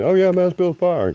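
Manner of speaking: mock suggestion. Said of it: "and go ahead".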